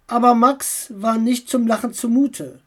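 plain reading voice

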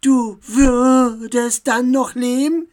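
loud, slow, high-pitched outrage; incensed elf, every word drawn out and forceful; furious. A narrator's reading. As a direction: fling the question out in fury. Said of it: "Jetzt wurde die Elfe wütend".

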